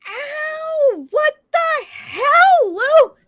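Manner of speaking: pained yell turning furious; angry shout. Acted. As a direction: yell it out in pain and rage. Kicked, yelling at Luke.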